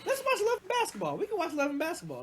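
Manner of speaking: high, lightly hurried retold suggestion; eager coaxing. Man retelling his movie pitch to a date, eager coaxing. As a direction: pitch it way up, imitating an eager invitation.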